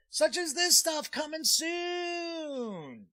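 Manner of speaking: high, loud promo call with a long-held last word; hyped announcer. Pretend. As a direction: stretch it out like a showy coming-soon plug. Plugging upcoming videos to viewers.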